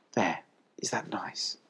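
slow, very soft motherly voice; almost whisper it, soothingly; tender. A narrator's reading. Collie having licked the piglet, soft and tender.

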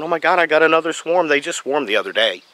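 mock freaking out. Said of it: "you're freaking out, going".

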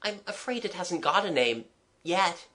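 timid. Acted. Child confessing to the artist, timid. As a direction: admit it shyly. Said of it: "I replied timidly".

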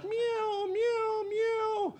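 very high cat meows; cat yowls. Acted. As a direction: yowl like an angry cat. Cats yowling again.

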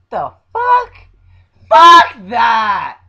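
very loud, high-pitched yell; outraged shout. Real, not acted. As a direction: blow up in disbelief, yelling. Ranting outburst about a silly video.

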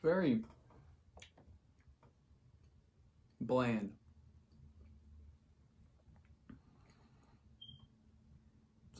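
talking between chews; chewing while talking. Real, not acted. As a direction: mouth partly full. Seen 1: Bitten cookie held up; lips pursed, chewing motion across frames.

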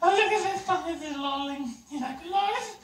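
cooing. Real, not acted. Cooing at a puppy.

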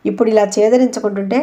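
ordinary delivery, nothing marked

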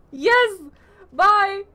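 high, loud, jubilant shouts; gleeful exclaiming. Real, not acted. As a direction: call out loudly in triumph. Delighted, running off as chicken.

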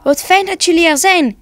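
high, delighted welcome; happily surprised. A narrator's reading. Head Santa welcomes the animals at his house, happily surprised. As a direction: greet everyone with pleased surprise. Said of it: "De hoofdkerstman was blij verrast".

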